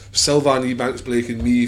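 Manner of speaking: normal talking voice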